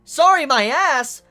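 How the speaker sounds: hammed-up outraged rebuke; mock angry retort. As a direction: snap back at him. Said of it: "he's like".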